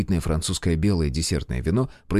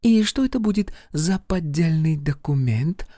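businesslike; 8 semitones higher than usual. Acted. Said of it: "Интонации мадам Аталь сделались такими, с какими она … обсуждала заключение нового контракта".

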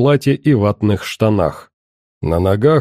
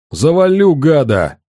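enraged shout; 4 semitones higher than usual. Acted. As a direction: scream the threat in rage. Enraged shout before shooting.

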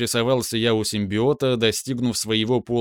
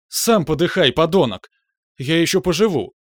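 loud furious yelling; angry shout; 5 semitones higher, 5 dB louder than usual. Acted. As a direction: hurl it at him in rage. Shouting back at teacher. (яростный крик)

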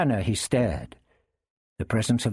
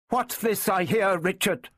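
put-on high, tense young woman; high-pitched, crackling restraint; suppressed emotion; 9 semitones higher than usual. A narrator's reading. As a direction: contain the agitation in a raised voice. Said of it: "her voice crackling with suppressed emotion".

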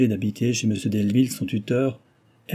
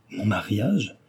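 astonished; slower than usual. A narrator's reading. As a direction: caught off guard by the word. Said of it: "très étonnée".

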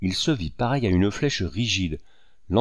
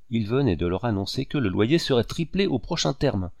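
ordinary delivery, nothing marked

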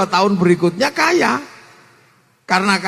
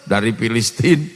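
calm Prophet; 11 semitones lower than usual. Acted. Rasul answers questioning companions.

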